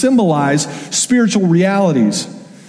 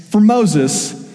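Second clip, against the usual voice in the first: ordinary delivery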